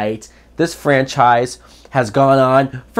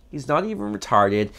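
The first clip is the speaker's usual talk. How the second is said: softer, flat aside; deadpan repeat; 3 semitones lower, 7 dB quieter than usual. Real, not acted. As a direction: drop it quietly to yourself. Deadpan repeat after outburst.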